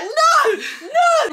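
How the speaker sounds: high, squealing no through laughter; laughing mock protest. Telling her friend how she fought boyfriend, high laughing protest.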